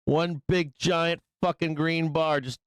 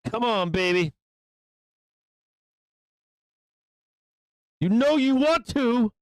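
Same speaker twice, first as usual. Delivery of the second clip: coaxing yell at chart; 2 semitones higher than usual. Real, not acted. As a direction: egg the chart on like a tease. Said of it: "yelling at the fucking bars".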